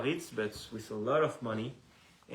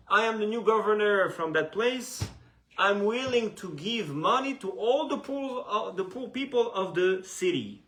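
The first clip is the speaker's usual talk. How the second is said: public announcement; 6 semitones higher, 5 dB louder than usual. Acted. Announcement to the city's poor.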